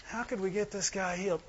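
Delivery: very soft puzzled musing; mock pondering. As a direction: wonder it under your breath, play-acting. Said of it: "You don't just say".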